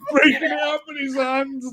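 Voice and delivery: high, cracking with laughter; laugh-crying as you say it. Real, not acted. Recounting the caretaker, breaking up laughing.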